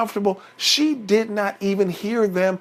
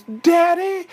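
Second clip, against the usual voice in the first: put-on high child calling for daddy; Baby Bear; 11 semitones higher than usual. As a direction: call out in a small high voice. Baby Bear calls his father.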